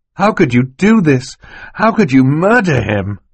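put-on high, loud boy's outcry; anguished accusation. Klaus accusing Stefano of murder, loud and anguished. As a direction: cry out the accusation in horror.

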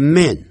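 man said with a short flat A; the mistaken short A heard as man; wrong man. Shown as a demonstration. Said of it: "不要念成man". (错误读法man，短音A)

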